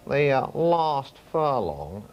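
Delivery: slow, drawn-out announcer voice; Norris McWhirter impression. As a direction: draw out every word like a record-book presenter. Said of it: "it sounds a bit like Norris McWhirter".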